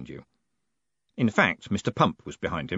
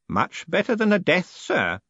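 put-on high old voice, firm; lightly voiced old man answering; old Groat, pointed; 9 semitones higher than usual. A narrator's reading.

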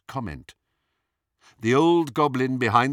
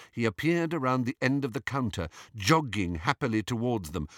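ordinary delivery

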